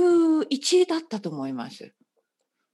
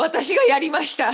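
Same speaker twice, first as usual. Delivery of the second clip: giggling mock confession; laughing through a fake confession; stifled giggles; 5 semitones higher, 4 dB louder than usual. Jokingly confesses a crime.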